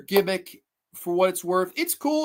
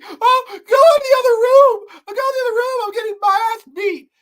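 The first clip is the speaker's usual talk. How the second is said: higher, louder wailing, half-sobbing and shouting; mock crying shout; 13 semitones higher, 9 dB louder than usual. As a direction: ham up a tearful mother shouting. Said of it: "a lot of whining and crying".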